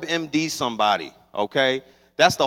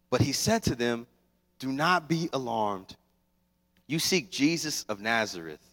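scripture reading; 5 semitones lower than usual. Acted. Preacher reading Mark sixteen to the congregation, gentle reverent reading. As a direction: read the verses gently and reverently. Reads scripture passage aloud.

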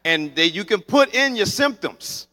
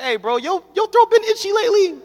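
anxious question to a friend; 6 dB louder than usual. Retold or imitated. Anxiously calling friends for reassurance.

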